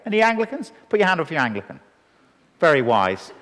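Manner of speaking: ordinary delivery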